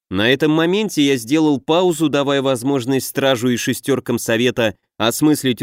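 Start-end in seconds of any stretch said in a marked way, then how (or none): none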